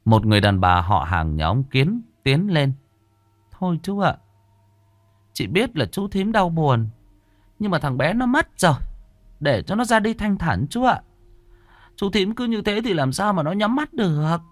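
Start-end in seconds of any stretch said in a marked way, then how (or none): 3.5-14.4 high-pitched woman consoling the bereaved; pleading female relative. Acted. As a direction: gently plead with him to let go, pitched high. Relative pleads with grieving father.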